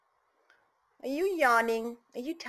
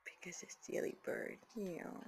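soft whispered teasing; whispered tease; 4 semitones lower, 12 dB quieter than usual. Real, not acted. Owner whispering to parrot, soft tease. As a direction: lean in and whisper it fondly.

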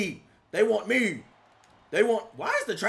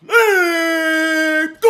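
loud, higher yell; hype shout; 5 semitones higher, 12 dB louder, much slower than usual. Real, not acted. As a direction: belt it out to hype the move.

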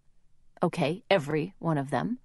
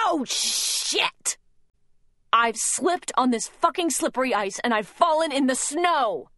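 furious ranting, higher and louder; a mom losing it completely, screaming curses at the frozen snow; cursing rage; 7 semitones higher, 4 dB louder, slower than usual. Acted. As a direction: spit out every curse in a mounting tantrum. She flips out kicking snow.

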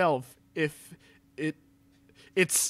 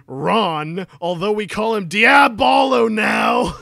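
shouted, much higher and louder; mock dramatic shout; 7 semitones higher, 10 dB louder, slower than usual. Reading to friends, yelling the goth name out. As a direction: bellow the new name like a grand reveal.

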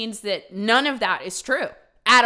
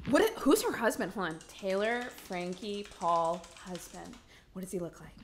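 hushed, softer nosy snooping; 4 semitones lower, 8 dB quieter than usual. Pretend. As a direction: lean in and snoop quietly, nosy. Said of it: "This is my nosy voice".

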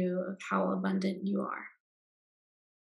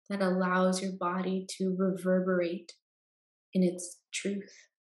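guided meditation. Acted. Guide leading listeners into Yoga Nidra rest, calm meditation voice.

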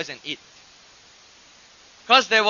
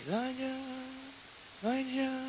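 still small voice; 4 semitones higher, 13 dB quieter than usual. Retold or imitated. God calling Elijah through the noise, faint and hushed. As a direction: call the name faintly, as if from far off. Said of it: "it may have been".

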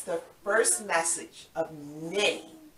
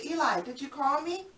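eager Samuel; 6 semitones higher than usual. Acted.

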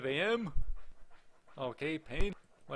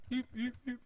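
high-pitched little beeps; imitated beeping; 10 semitones higher than usual. Player clicking through the game's views, playful beeping. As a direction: peep it like a small machine.

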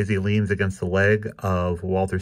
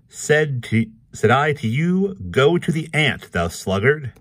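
pitched-up declaiming cartoon figure; Gooseberry Sprigg; 7 semitones higher, 4 dB louder than usual. A narrator's reading. Sprigg admonishes the dog, raised declaiming voice. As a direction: proclaim it higher and louder, dropping at the end.

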